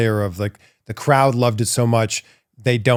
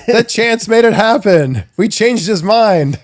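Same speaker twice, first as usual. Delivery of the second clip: high, loud, giddy crowd voice; gleeful fans seizing their chance; hammed-up excited crowd cry; mock excited fans; 11 semitones higher, 8 dB louder, slower than usual. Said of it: "we're like".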